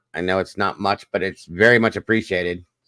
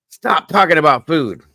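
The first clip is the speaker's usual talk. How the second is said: higher, louder playful complaint; mock exasperated; 9 semitones higher, 8 dB louder than usual. Real, not acted. Hungry host scolds chat about food, mock exasperated. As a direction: whine at the chat for tempting you.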